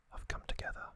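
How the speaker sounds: usual speaking voice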